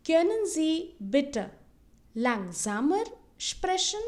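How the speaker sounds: slow German, pausing after "bitte"; slow model phrase. Shown as a demonstration. Teacher models a polite request for learners, slowly in chunks. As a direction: draw out "Können Sie bitte langsamer sprechen" in small chunks. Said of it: "repeat it twice so that you can listen".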